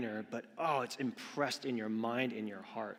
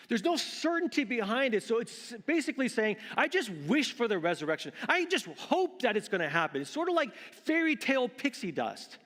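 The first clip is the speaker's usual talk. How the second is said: high, loud, urgent pulpit delivery; fervent sermon, voice lifted high; impassioned preaching; 11 semitones higher, 6 dB louder than usual. Real, not acted. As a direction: preach it hard with raised pitch and volume. Preaches at full force.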